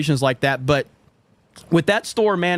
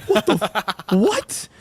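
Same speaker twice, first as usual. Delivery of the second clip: retold shock, cut off then squeaked; startled disbelief; 4 semitones higher, slower than usual. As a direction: voice climbing high on the last word. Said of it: "I'm scared to death".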